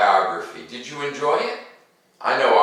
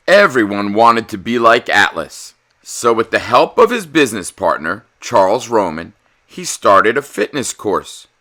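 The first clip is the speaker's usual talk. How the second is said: put-on lively, louder read-aloud for kids; animated storybook narrator; story narration; 5 dB louder than usual. A narrator's reading. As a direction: read it like a bedtime picture book. Reads picture book aloud.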